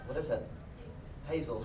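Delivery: ordinary talk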